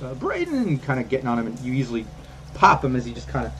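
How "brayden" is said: high call of a friend's name. Acted. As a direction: cry the name out, pitched high. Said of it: "calling out".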